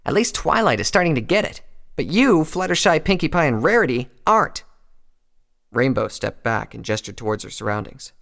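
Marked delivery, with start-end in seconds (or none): 0.0-4.6 high, loud angry scolding; Rainbow's angry lashing. A narrator's reading. Rainbow lashing out at Applejack, angry and raised. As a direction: lash out at a friend, voice raised. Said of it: "stunned by the angry lashing from her friend".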